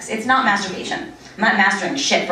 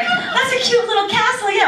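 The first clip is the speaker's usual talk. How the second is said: high, slow, sing-song fake sweetness; mock-sweet cooing; 13 semitones higher than usual. Comic talking to little girl at sandcastle, mock sweet. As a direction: coo innocently at a small child.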